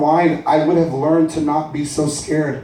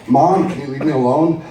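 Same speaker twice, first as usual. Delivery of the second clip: ordinary delivery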